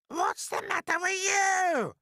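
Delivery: very high, loud, drawn-out screech. Acted. Cruel aunt rounding on the crying boy, screeching. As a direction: screech the question at full pitch. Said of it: "Aunt Spiker screeched".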